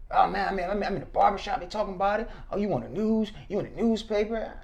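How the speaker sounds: lightly voiced older stranger, accusing; a hint of a scolding older man; imitating the older man.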